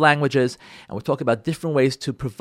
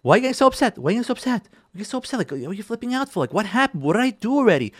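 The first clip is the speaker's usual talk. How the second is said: higher, softer incredulous protest; baffled spouse; 5 semitones higher, 4 dB quieter, faster than usual. Acted. Spouse questioning the other's upset, baffled. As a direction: plead innocence in a high, puzzled voice. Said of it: "what you hear from your spouse".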